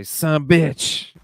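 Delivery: Southern "son of a bitch" run together as "sumbitch". Shown as a demonstration. Host shows what everybody likes hearing, Southern run-together phrase. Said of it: "It's just mixed up together".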